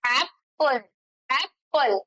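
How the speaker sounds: Apple split in two, "ap" then "pul", with a clear gap; pause between syllables. Shown as a demonstration. Teacher showing two pauses in apple, split syllables. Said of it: "हमने दो बार pause लिया".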